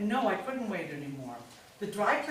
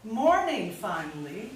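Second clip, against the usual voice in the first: sarcastic greeting; 7 dB louder, slower than usual. Acted. Mother greeting late-rising son sarcastically.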